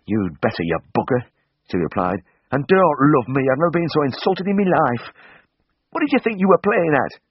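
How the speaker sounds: high-voiced angry woman telling him off; an insulted lass giving him what for; scolding woman. A narrator's reading. Lydia telling off Tristan, an indignant high voice.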